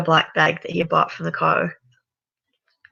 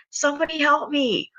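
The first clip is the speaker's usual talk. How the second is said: desperate call for help; 10 semitones higher than usual. A narrator's reading. Mick begging anyone out there to help, high desperate call. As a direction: cry out for rescue in panic. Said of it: "Mick screamed again".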